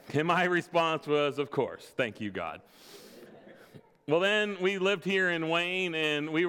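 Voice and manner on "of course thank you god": mock resigned thanks. His resigned reaction to news.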